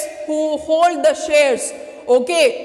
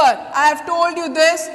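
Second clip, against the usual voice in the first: normal talking voice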